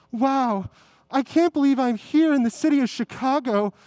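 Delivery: normal talking voice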